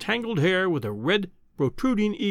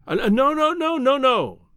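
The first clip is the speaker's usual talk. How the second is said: high, louder urgent protest; cried out; 9 semitones higher, 4 dB louder than usual. A narrator's reading. Young man begging the professor not to dismiss him, urgent cry. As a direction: blurt the no's out in a rush, higher. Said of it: "I cried".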